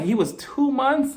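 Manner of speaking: slow, high-pitched disbelief; "two months" drawn out in disbelief; incredulous. Retold or imitated. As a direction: stretch out TWO MONTHS high, as if it's absurd. Repeats the fact in disbelief.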